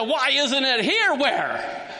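slow, high-pitched "why isn't it here"; challenging question. Shown as a demonstration. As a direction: demand to know why it is missing. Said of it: "Or the where, why isn't it here?".

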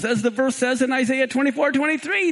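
ordinary talk

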